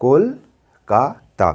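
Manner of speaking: Kolkata broken into কল, কা, তা with pauses; syllable-by-syllable Kolkata, a gap after each; breath between syllables. Shown as a demonstration. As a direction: say Kolkata in three slow beats. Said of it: "দম নিয়ে নিয়ে যদি বলি".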